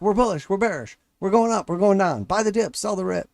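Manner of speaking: high, frantic back-and-forth chatter; mock frantic traders. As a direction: rattle off conflicting calls, panicked. Mocking panicked traders.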